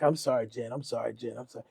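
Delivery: low, soft repeated apology; quiet apology. Retold or imitated. Fifty apologizes to shocked Jennifer, quiet retold apology. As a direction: hurry out the apology under your voice.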